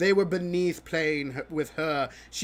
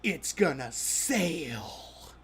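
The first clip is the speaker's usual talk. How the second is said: defiant whisper; 4 semitones lower, 4 dB quieter, slower than usual. Retold or imitated. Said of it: "she looks at the kid".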